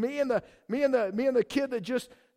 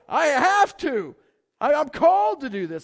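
acted fervent conviction; 5 semitones higher, 8 dB louder than usual. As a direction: declare it like an irresistible calling. Voices someone compelled by calling.

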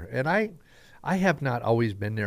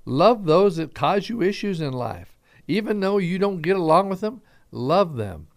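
higher, louder, urgent proclamation; preaching Jesus' words; 8 semitones higher, 5 dB louder than usual. Retold or imitated. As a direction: call the church to love their enemies. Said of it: "he was saying".

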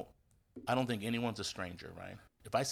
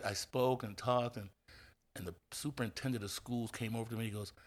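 ordinary talk

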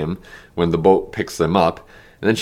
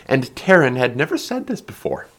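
usual speaking voice

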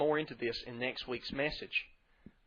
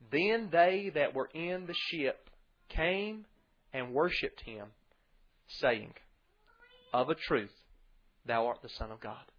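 louder, measured Bible reading to the congregation; scripture reading; 5 dB louder, slower than usual. Acted. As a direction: read the King James text out with weight.

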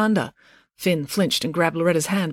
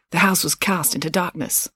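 ordinary delivery

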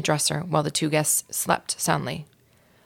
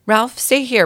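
pleading mother; 6 semitones higher, 6 dB louder, slower than usual. Acted. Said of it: "pleaded his mother".

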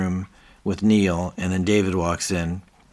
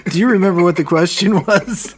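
laughing; 11 semitones higher, 8 dB louder than usual. Retold or imitated. Retelling Keith's quote, laughing.

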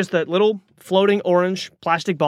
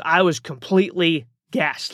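ordinary delivery

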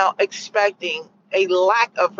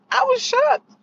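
much higher, rising shocked cry; shocked squeal. Shown as a demonstration. Repeating her point, a high squealed I was shocked. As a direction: squeal it in disbelief. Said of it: "needless to say".

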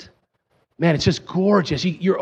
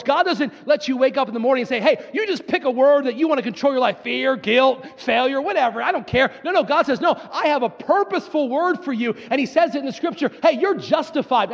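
animated, high-pitched sermon delivery; impassioned preacher acting out God's word to the congregation; full-force preaching; 8 semitones higher than usual. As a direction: preach it with rapid, rising fervor.

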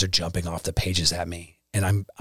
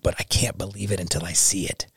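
put-on hushed, breathy-free whisper of the old doubt. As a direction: whisper it like a private confession. Recalling doubt before faith.

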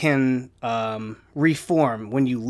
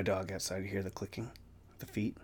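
whispered aside; 6 semitones lower, 19 dB quieter than usual. Real, not acted. Quiet aside while listening to dog.

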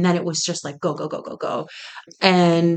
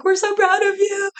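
very high, sing-song congratulations; imitated gushing praise; 13 semitones higher than usual. Egyptian community messaging the founders after the show, imitated gushing. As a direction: gush like a proud supporter, pitched way up.

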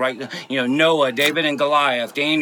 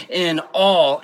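"all" hit harder than "In"; stress on ALL; 4 dB louder than usual. Shown as a demonstration. Preacher quoting the verse to make his point, stress on "all". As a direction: punch the word "all". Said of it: "emphasis is on the word all".

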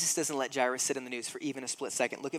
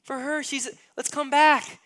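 a hint of frantic, high-pitched impatience; lightly voiced Jairus pleading to hurry; impatient father; 11 semitones higher, 4 dB louder than usual. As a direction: Jairus pressing Jesus to hurry, mildly frantic. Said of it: "what's happening with Jesus and Jairus".

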